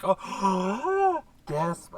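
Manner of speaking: imitating someone's fake surprise; mock shocked gasp. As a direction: act exaggeratedly shocked. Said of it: "Alicia still acted all like".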